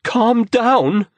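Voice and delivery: high, loud, indignant repeat; indignant shout of disbelief; outraged echo. Acted. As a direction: throw his words back at him. Repeats his words, outraged.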